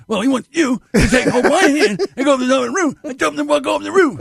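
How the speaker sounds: laughing high-pitched boss impression; Herb. Mechanic relays boss's order to coworker, high laughing impression. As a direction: mimic the angry boss, high and amused. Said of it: "My God, you do that well".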